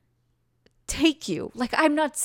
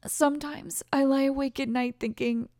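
ordinary talk, nothing marked

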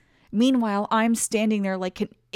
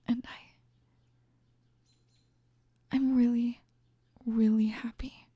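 shy whisper. Acted. Shy admission of happiness.

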